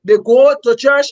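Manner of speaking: usual speaking voice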